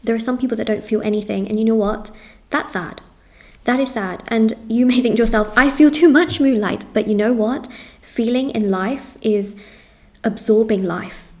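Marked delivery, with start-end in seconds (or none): 5.6-6.8 exasperated cry. Pretend. Imagined client complaining about herself.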